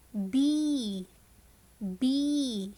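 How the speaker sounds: "B" drawn out as "beee"; slow phonics model of "B"; long E in bee. Shown as a demonstration. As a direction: hold the ee in "B". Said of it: "It is the long sound".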